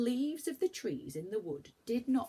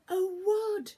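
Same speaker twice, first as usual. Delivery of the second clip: high, loud squeal of delight; thrilled to find it; delighted cry; 10 dB louder than usual. Acted. Beth spots the wood, delighted. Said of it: "said Beth in delight".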